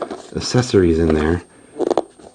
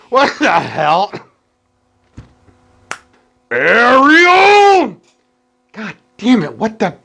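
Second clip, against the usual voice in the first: high cartoon voice; 13 semitones higher, 7 dB louder, slower than usual. Comedic voice skit.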